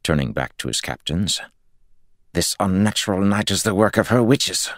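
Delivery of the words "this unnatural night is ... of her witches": bitter accusation. A narrator's reading. Lord blames witches.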